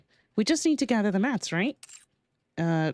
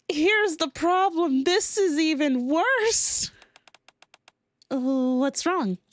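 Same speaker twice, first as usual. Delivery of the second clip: mock dramatic read; 8 semitones higher, slower than usual. Reads lines, higher and slow.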